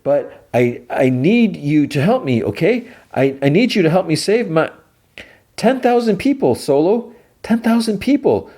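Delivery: very high, urgent plea; imitated desperate appeal; begging. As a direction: beg for their lives through a closed door. Begging Solo to save people.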